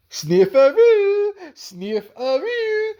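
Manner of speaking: high, slow teasing call of sniffaroo; a drawn-out high mocking tease of a nickname; mocking sing-song tease. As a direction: stretch out sniffaroo like a taunting kid. Teasing nickname voiced in a story.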